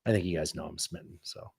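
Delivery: low, soft and tender; hushed, sincere newlywed contentment; heartfelt. Real, not acted. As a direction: speak quietly from the heart, smitten. Heartfelt talk about marrying Natasha.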